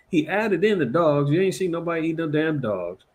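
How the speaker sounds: ordinary delivery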